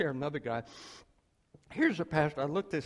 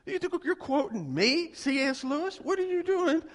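higher and louder, exasperated challenge; incredulous retort; 14 semitones higher, 6 dB louder than usual. Retold or imitated. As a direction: push back, baffled and annoyed. Pastor objects to Lewis quote.

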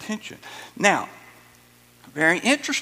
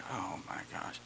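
breathy whispered oh my gosh; embarrassed whisper; 11 semitones lower, 16 dB quieter than usual. Retold or imitated. Family member cringing at the uncle's joke, whispered. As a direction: mutter it under your breath, mortified.

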